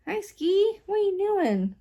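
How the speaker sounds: high, cooing pet greeting; pet talk. Real, not acted. Greeting Ski the pet, high pet-talk voice.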